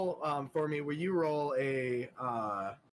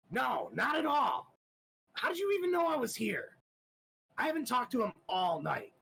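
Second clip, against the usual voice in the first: shaky denial; 10 semitones higher than usual. Acted. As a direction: caught out and scrambling to deny it. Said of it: "a little shake".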